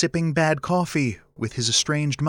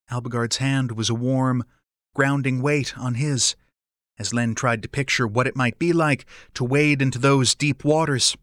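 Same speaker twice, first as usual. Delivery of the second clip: ordinary delivery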